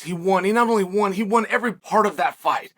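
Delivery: ordinary talk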